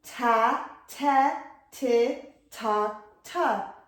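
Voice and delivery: Ta, te, ti, ta as separate blended syllables; blend demonstration. Shown as a demonstration. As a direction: model "Ta, te, ti, ta" one blend at a time. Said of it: "Now listen".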